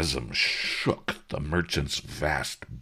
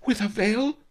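loud, high, urgent questioning; high-pitched frantic merchant; alarmed merchant; 8 dB louder than usual. A narrator's reading. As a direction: blurt the worry in rising panic. Merchant frets about dust.

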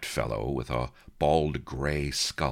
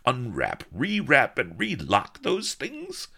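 indignant executioner; 13 semitones higher than usual. A narrator's reading. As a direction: demand pity for the working man, voice climbing. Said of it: "leveled an indignant finger".

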